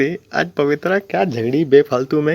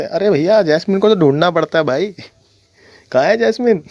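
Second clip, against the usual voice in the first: exaggerated calling out; 6 semitones higher than usual. Real, not acted. Mocking fans searching for Jasmine.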